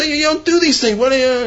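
high-pitched indignant mock yell; imitated screaming scolding. Rav scolding the apologetic Yid, mock screaming. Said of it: "He starts screaming at him".

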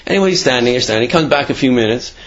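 usual speaking voice

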